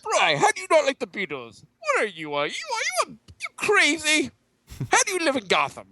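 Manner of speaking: high, hammed-up villain voice; mock villain. Villain berating a co-host over the Beatles, high mock outrage. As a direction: scold incredulously in a silly high villain voice. Back in the villain impression, scolding.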